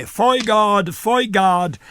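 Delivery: high, loud call of a name; calling offstage. Retold or imitated. Fellow actor summoning him on cue, loud call.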